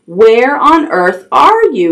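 ordinary delivery

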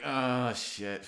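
mock-tense, loud outburst; clenched-up panic. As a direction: act out clenching up as the trip hits. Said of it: "clenched position and be like".

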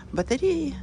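usual speaking voice

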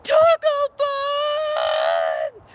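a high, loud, stretched-out cheer; excited shout. Acted. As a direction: belt it like a game show opener. Launching into taco jingle.

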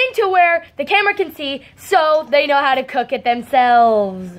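ordinary delivery

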